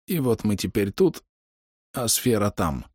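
a hint of a dying man's effort; wounded archer. A narrator's reading. Dying archer talks to his friend, weakened voice. Dying man coughing blood speaks.

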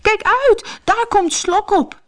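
very high, panicked fish shriek; high-pitched alarmed call; warning cry. A narrator's reading. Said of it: "riepen de vissen".

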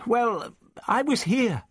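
high, halting, lost voice; bewildered, faltering finish; helpless. A narrator's reading. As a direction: trail into a helpless explanation. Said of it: "she gestured helplessly".

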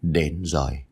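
low, resigned sigh of words; grave and low, sighed out. A narrator's reading. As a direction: let it sink down like a sigh. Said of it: "thở dài".

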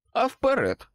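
bright, high, cocky "go ahead"; cheerful tone. A narrator's reading. Dresden cheerfully dares his captors to go ahead. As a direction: taunt them cheerfully. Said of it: "сказав я бадьорим тоном".

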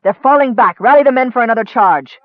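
loud, high rallying order; rally the troops at full voice; fierce battlefield shout; furious command. A narrator's reading. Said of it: "The knight shook him off furiously".

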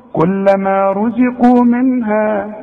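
slow كلما رزقوا منها with a light زاي and a heavy راء and قاف; careful recitation. Shown as a demonstration. Teacher showing each letter's due, slow. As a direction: give every letter its due slowly. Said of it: "شوف".

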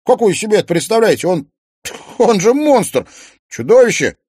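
fast, loud panicked denial; panicked protest. Acted. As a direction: rattle it out in panic.

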